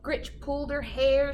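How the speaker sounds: ordinary delivery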